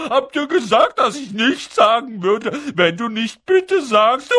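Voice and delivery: high, loud, laughing poltergeist voice; gloating taunt. A narrator's reading. Peeves gloating over Filch, laughing taunt. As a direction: rub it in through laughter.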